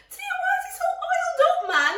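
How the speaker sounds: high, fast, laughing disbelief; incredulous laugh. Retold or imitated. Telling her sister her baby's face is oily, high and laughing. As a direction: squeal it through a laugh. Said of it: "I said to her".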